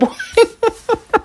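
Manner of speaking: high, soft giggle at herself; self-amused high chuckle; real laugh. Real, not acted. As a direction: laugh lightly at your own habit. Laughs at herself washing again.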